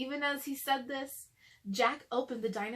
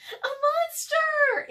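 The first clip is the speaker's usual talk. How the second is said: very high, loud and slow alarmed cry; frightened cry; 9 dB louder, much slower than usual. Acted. Annie cries out at the creature in the window, terrified. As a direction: cry out in terror. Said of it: "Annie cried".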